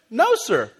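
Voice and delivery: a hint of a child pleading innocence; small boy's voice. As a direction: play the innocent little son. Son answering dad's questions.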